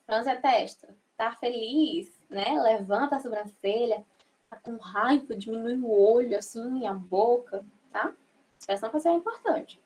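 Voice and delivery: ordinary talk, nothing marked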